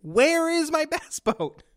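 high, slow, half-laughing demand; mock furious demand, laughing. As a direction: ham up the outrage and crack up. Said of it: "and I said".

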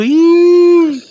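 high, loud shrieking "ii"; imitated crowd squeal. Imita el grito de la gente. (chillido agudo imitando al público)

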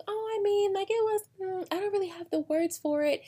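high, hesitant mock uncertainty; fumbling for words. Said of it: "And you're like".

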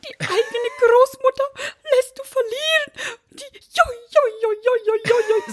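put-on high, wailing old granny, whining; old grandma voice. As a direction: wail like a sore-losing grandma. Grandma voiced in family story.

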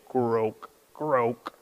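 low, soft croaking like a big frog. Retold or imitated. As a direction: grandpa croaks like a big bullfrog, quietly. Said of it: "make the other sound of the big frogs".

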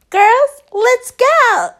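high, loud, drawn-out call to friends; excited rallying call. Retold or imitated. Woman rallying her girls, high excited call.

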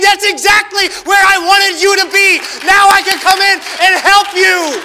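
high, loud, slow preaching shout; a preacher shouting God's promise to the congregation; fiery preaching. Real, not acted. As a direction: preach it full force to the crowd. Preaching at full force.

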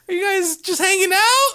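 very high imitated teacher voice; chirpy teacher. Teacher calls from car to students, chirpy teacher. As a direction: call out a high, friendly question.

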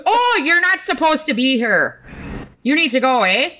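high, hammed-up Canadian accent; attempted Canadian accent. Shown as a demonstration. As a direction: play a scolding Canadian official, accent laid on thick. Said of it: "I can't even do Canadian right now".

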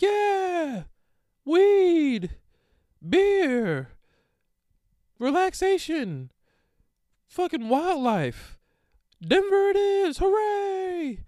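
very high, loud cartoon cheer; mock hype. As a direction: oversell the trip like a giddy group chat. Hyped group chat about Denver.

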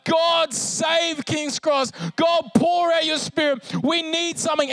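high, loud, drawn-out plea to heaven; imitated crying out. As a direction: cry it out like a roaring congregation. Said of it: "them just crying out loud".

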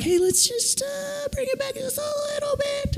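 very high, soft, strained little voice; gentle correcting. Acted. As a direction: coax it back in a tiny high squeak. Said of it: "correcting is kinda like".

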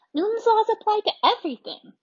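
high, loud, I'm-right-and-that's-final; know-it-all insistence. A narrator's reading. Jessie insisting to her siblings, loud know-it-all certainty. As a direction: declare it like nobody could argue. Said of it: "with her "I'm right and no one can convince me otherwise" voice".

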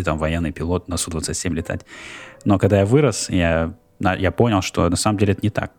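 normal talking voice

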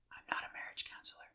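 quick whispered aside; hushed, hurried confession under the breath; whispered disclaimer. Real, not acted. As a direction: whisper it like a confidential admission. Joking hushed disclaimer of expertise.

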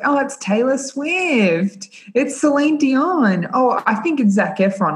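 high, slow, dopey guessing; mock judges guessing. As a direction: drag out each wrong guess. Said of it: "people are like".

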